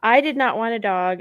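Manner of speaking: usual speaking voice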